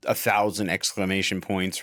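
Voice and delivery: ordinary delivery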